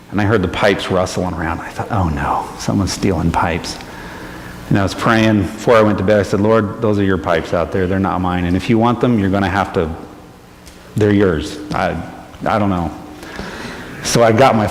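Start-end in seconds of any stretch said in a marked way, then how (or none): 0.1-3.8 hushed worry. Retold or imitated. Scared at night, pipes rustling. 6.4-12.9 soft prayer. Retold or imitated. Quiet prayer before bed.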